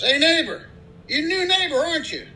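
high-pitched voice for the friendly country neighbor. Acted. Guy in pickup greeting new neighbor, high-pitched friendly voice.

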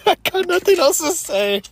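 high, laughing through the words. Real, not acted. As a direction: giggle the line out. Still laughing after joke.

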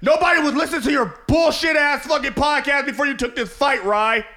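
loud, high mocking shout; Jake Paul impression. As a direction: yell it as a cocky rival. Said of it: "Jake Paul gonna be like".

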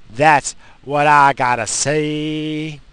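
"That's what I gotta say" slow, loud, every word leaned on; slow emphatic restatement. Real, not acted. Restates closing line, emphatic.

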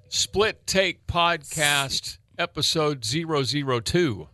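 slow, drawn-out show intro; announcer voice. Acted. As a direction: announce the episode like a host.

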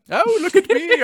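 mock pompous accent. Mocking herself for chat, in a hammed-up pompous accent. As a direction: ham up a grand self-important hero voice. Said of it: "I say things in that accent".

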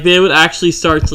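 ordinary talk

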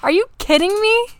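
high-pitched incredulous protest; mock outrage. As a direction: pitch it way up in hammed-up disbelief. Acted outrage at a bad text.

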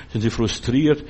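ordinary talk, nothing marked

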